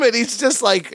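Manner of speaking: ordinary talk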